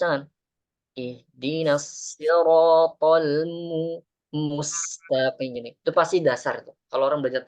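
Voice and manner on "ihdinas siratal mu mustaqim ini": slow mustaqim with lips pushed forward; full rounded damma. Shown as a demonstration. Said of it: "harus mencucu".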